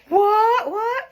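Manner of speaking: squealed high mock "what"; fake shock. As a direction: gasp it out like a stunned fan. Mock shock at own reveal.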